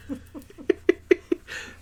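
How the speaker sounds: a high airy laugh rising up; breathless laugh. Real, not acted. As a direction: let a breathy laugh squeak upward.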